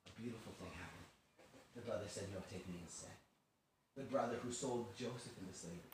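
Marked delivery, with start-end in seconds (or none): 2.3-3.1 very low, hushed plea; subdued acted entreaty; quiet self-offering. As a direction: offer yourself softly. Retold brother offers himself.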